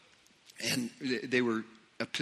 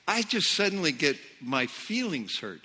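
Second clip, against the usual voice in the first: ordinary talk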